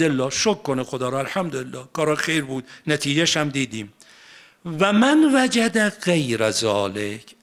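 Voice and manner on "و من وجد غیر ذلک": slow, chanted Arabic phrase; Quran recitation. Acted. Preacher re-reciting the verse, slow chant.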